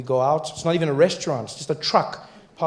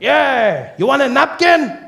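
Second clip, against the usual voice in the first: high, loud vendor voice; a high, loud offer through the truck window; taco guy; 11 semitones higher, 6 dB louder, slower than usual. Retold or imitated. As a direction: offer it like a pushy street vendor. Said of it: "He's like".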